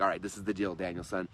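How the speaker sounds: a hint of a low, soft Miyagi voice; Mister Miyagi impression. Retelling the movie to students, a lightly voiced low, soft Mister Miyagi. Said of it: "Mister Miyagi and Daniel-san when he's like".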